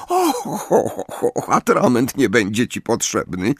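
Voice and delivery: high gleeful sugary titter; sweetly amused cruelty. A narrator's reading. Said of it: "nutka wesołości".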